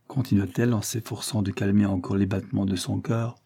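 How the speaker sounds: ordinary delivery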